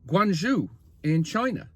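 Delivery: ordinary delivery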